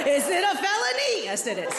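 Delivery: mock game show reveal; game show host. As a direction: build it up, then drop for the verdict. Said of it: "really fucked up game show".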